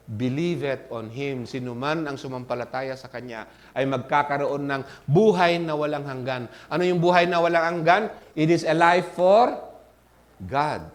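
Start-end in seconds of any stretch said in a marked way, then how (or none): none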